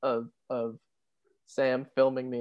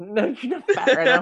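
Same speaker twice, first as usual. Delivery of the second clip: laughing; 11 semitones higher, 7 dB louder than usual. Real, not acted. Laughing denial.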